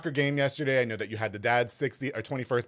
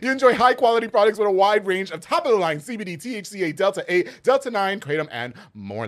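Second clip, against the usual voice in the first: radio ad read; 7 semitones higher, 7 dB louder than usual. Shown as a demonstration. Sponsor read, radio style.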